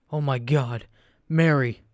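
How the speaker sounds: loud, desperate shout rising on Mary; anguished cry. A narrator's reading. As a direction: cry out her name in horror. Old man finds dying wife.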